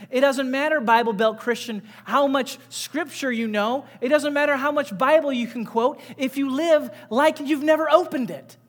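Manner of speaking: loud, pressing sermon voice; fiery preaching. Acted. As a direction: preach it hard to the congregation. Preaching to the church.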